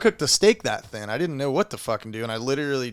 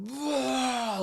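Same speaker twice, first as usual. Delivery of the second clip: mock retch; 12 semitones higher than usual. Retelling trying to puke.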